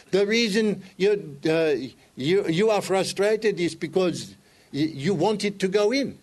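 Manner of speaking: put-on calm teacher in an Indian accent. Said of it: "he's speaking with an Indian accent".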